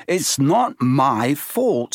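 slow, defensive team captain; a hint of protest. A narrator's reading. Wood defending himself.

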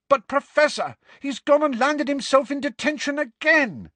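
very high, exasperated wail; loud shouted protest. A narrator's reading. Girl complains to professor about teammate's detention, loud shouted protest. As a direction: protest at the top of your pitch. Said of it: "shouted so loudly".

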